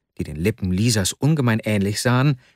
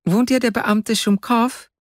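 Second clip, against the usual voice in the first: put-on high-pitched child asking a question; a small child's high, questioning voice; 12 semitones higher than usual. A narrator's reading. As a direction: pipe up high like a little child. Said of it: "ertönte eine Kinderstimme auf der Treppe".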